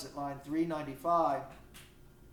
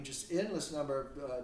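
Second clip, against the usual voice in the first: usual speaking voice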